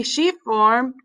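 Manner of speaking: usual speaking voice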